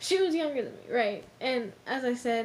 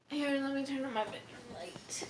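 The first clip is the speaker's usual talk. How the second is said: whispering; 7 dB quieter, faster than usual. Real, not acted. Turning on light, speaking softly.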